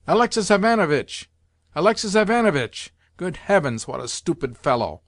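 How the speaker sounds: high, loud calling out, sinking into exasperation; high-pitched, exasperated cry; despairing wail. A narrator's reading. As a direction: wail the name despairingly from afar. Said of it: "came in a despairing wail from the veranda".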